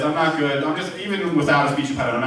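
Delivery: usual speaking voice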